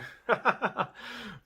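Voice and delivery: high giggling laugh in a quick burst; self-amused laugh. Real, not acted. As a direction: laugh at catching yourself. Laughs at own fast talking.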